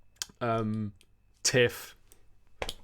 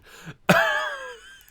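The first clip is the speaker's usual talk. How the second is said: high loud laugh; 6 dB louder than usual. Real, not acted. Joke about Nando's.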